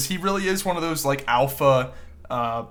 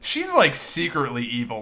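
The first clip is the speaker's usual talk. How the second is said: usual speaking voice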